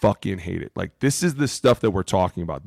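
normal talking voice

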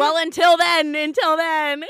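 loud high gloating taunt. Real, not acted. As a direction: rub it in, loudly.

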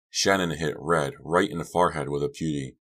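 usual speaking voice

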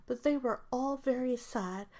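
plain reading voice

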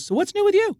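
high, breezy celebrity voice. Retold or imitated. [name] chatting up the surfer, breezy and high. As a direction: lean back and chat breezily. [name] casually leaning back.

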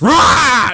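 high, loud monster screech; jump-scare roar, pitched high; mock screech. Dad bursts in to scare his son, high imitated screech. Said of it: "just, like, screeches at me".

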